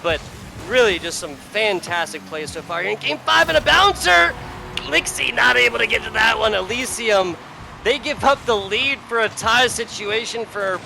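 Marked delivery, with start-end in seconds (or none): 2.8-10.8 excited play-by-play. Real, not acted. Caster calling a bouncer in game five, excited play-by-play. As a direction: burst out on the goal, then settle.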